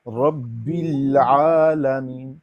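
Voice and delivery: رب العالمین with the لام held, no release; slow careful recitation. Shown as a demonstration. Teacher repeats the verse carefully. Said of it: "لام پر جب ساکن ہو".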